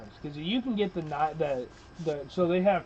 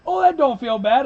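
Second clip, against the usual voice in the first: higher, louder surprised newcomer; newcomer's surprise; 8 semitones higher, 8 dB louder than usual. Retold or imitated. Newcomer reacts to his first shot, mock surprise. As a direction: sound pleasantly surprised by the recoil. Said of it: "He goes".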